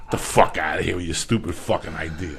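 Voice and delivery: ordinary talk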